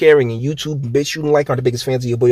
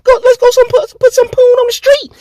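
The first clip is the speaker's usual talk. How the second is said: very high, fast hammed-up pimp caricature; mock pimp voice; 5 dB louder than usual. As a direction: ham up a street hustler.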